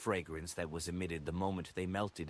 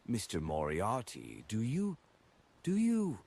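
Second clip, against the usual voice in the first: halting, rising to a high unsure squeak; a hesitant man fumbling his question; 5 semitones higher, 3 dB louder than usual. A narrator's reading. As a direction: stumble over the question nervously. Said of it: "before asking hesitantly".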